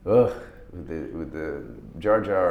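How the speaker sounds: low mock Jar Jar groan, slow. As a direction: sneer out the name like a fed-up fan. Mocking Jar Jar in prequels.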